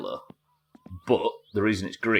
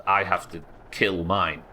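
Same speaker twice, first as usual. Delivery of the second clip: ordinary talk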